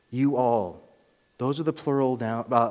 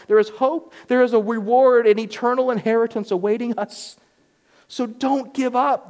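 impassioned preaching; 10 semitones higher, 5 dB louder than usual. Acted. Pastor urging his congregation to hold fast, impassioned preaching. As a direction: lean in and urge them not to give up.

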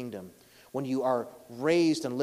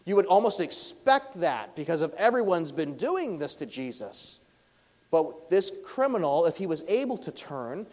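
loud, raised preaching voice, pitched higher; full-force preaching; 4 semitones higher, 8 dB louder than usual. Acted. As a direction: preach it out to the whole room.

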